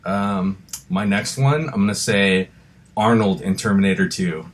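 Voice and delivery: ordinary talk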